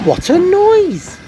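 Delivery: high, loud startled cry; surprised exclamation. Acted. Fat Controller startled by Gordon's hissing, surprised. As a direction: burst out in surprise. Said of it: "said the Fat Controller".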